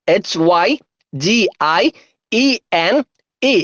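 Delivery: letters in pairs with long pauses; unhurried, separated letter names; slow spelling. Shown as a demonstration. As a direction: spell it out slowly for dictation. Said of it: "ഒന്നുകൂടെ സ്പീഡില്".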